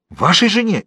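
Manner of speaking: high, startled question; frightened exclamation. A narrator's reading. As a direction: cry out in alarm, pitch jumping up. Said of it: "испуганно воскликнул Фалуа". (испуганный высокий возглас)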